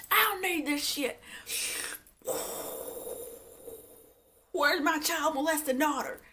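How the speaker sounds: high, hammed-up huff; mock outrage. [name] quitting the stage, mock outraged. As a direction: storm off in a high imitated voice.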